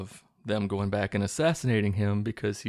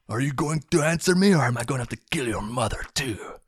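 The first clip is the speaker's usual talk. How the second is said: menacing threat; 5 semitones higher, 3 dB louder than usual. Retold or imitated. Villain threatens the kid.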